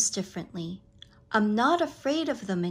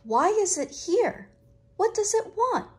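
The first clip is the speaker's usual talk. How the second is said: higher, puzzled questions to herself; puzzled wondering; 9 semitones higher than usual. A narrator's reading. Child puzzling over the problem, higher and wondering. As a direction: wonder aloud at the strange thing. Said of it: "I thought".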